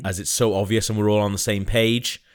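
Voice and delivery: ordinary talk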